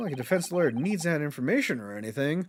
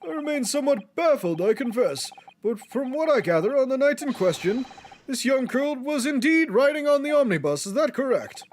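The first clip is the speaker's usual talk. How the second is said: lightly voiced stern judge; formal judge, slightly raised pitch; 11 semitones higher, 4 dB louder than usual.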